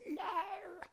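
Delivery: a high mock voice of the enemy saying No; high mocking refusal from the enemy; opposing voice. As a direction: squeak out the opponent's No. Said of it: "and something says".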